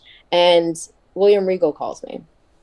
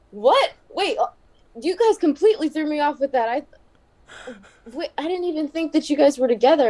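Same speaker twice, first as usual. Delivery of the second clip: higher, stunned double take; surprised; 8 semitones higher than usual. Retold or imitated. Boss reacting to the surprise engagement, shocked. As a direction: sound thrown off completely.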